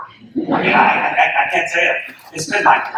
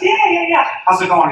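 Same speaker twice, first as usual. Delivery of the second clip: eager recognition. Retold or imitated. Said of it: "And I said".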